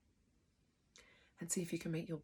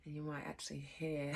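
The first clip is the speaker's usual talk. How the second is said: ordinary delivery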